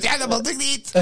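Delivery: put-on a fussy old lady protesting in a high voice; mock grandma voice. Imitating grandma.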